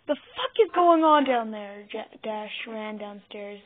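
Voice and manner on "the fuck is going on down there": loud, raised-pitch alarmed question; worried. A narrator's reading. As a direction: call it down, higher and worried.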